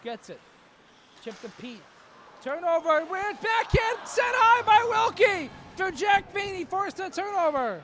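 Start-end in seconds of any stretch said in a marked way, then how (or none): 2.4-7.8 much higher and much louder, a full shout; shouted play-by-play. Real, not acted. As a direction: yell the action as it explodes. Wild sequence, shouting the play.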